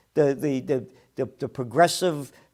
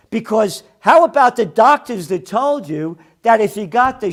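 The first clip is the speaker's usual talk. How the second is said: louder, higher furious tirade; angry rant; 6 semitones higher, 9 dB louder than usual. Real, not acted. Host rails at news networks about vaccine promises, shouting angrily. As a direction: blast the networks with outrage, voice climbing.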